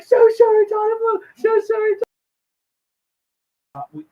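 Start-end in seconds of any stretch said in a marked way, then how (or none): 0.0-2.0 very high frantic screech; hammed-up panicked shrieking; mock scream. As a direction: scream it high, as if shooing off a threat. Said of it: "you have to scream".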